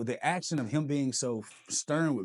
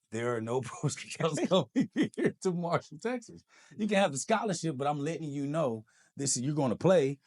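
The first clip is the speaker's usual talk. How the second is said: laughing coach; 5 semitones higher, 5 dB louder than usual. Retold or imitated.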